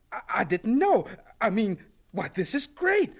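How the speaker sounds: flustered surprise rising into high delight; happily surprised. Acted. Scientist learns he has been given an assistant, happily surprised. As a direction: stammer first, then soar with pleasure.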